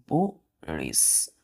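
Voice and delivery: बुरिस very slowly and low, split "boo, rish"; syllable by syllable. Shown as a demonstration. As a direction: pause in the middle of बुरिस, voice dropping. Teacher breaks word into syllables.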